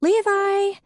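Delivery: high, loud shout of his name; Faith calling. A narrator's reading. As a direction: summon him from the living room. Faith calls her husband again.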